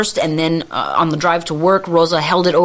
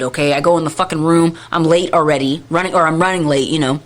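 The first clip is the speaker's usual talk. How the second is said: normal talking voice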